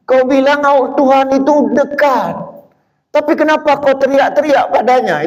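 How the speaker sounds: declaiming a poem. Retold or imitated. Preacher reciting the poem again, high and dramatic. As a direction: throw the question at the crowd. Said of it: "Itu buat puisi".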